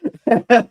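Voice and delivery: short high burst of laughter. Real, not acted. Recalling the friend's bear story, real laugh.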